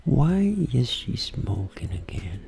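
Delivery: slow, breathy whisper to oneself; intimate voice. Shown as a demonstration. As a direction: whisper it slowly, only for yourself. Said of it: "Vou falar em voz baixinha". (voz íntima, sussurro lento para si mesmo)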